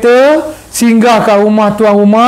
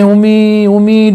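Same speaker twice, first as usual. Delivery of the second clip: lightly imitated toddler whining for mum; drawn-out, mildly tearful little call; mock child crying; slower than usual. Toddler crying to mother from under the bed, mildly imitated whine. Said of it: "dia pun nangis-nangis".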